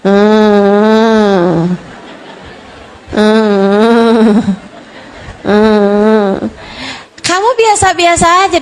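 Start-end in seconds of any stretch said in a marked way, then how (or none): 0.0-6.4 imitated low, droning fake cry; long monotone "hmm" like a kid barely crying; mock whimper, held on one low note; half-hearted child's whine. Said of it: "nangisnya kayak gitu tuh".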